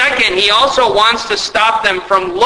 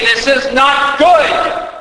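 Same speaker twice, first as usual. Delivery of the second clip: higher, slow, half-shouted protest; mock complaint shout; 6 semitones higher, slower than usual. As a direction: call it out like a sulking grumbler. Voicing complaint to God.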